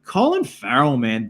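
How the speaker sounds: ordinary talk, nothing marked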